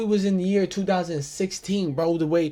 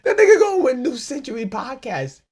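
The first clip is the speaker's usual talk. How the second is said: loud, high, disbelieving rant; incredulous outburst; 9 semitones higher, 6 dB louder than usual. Real, not acted. Host ranting about his rival's awards, loud and disbelieving. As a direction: blurt it out in disbelief.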